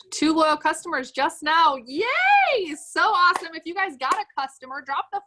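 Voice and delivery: high, loud, delighted celebration; excited cheer. Real, not acted. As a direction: cheer their win with a soaring yay. Cheering a viewer's sales news.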